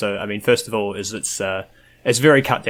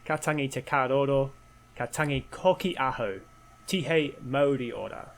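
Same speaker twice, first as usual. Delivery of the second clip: formal Māori greeting; 4 semitones higher, 7 dB quieter than usual. Acted. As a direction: recite it like a formal welcome. Opening Māori mihi, formal.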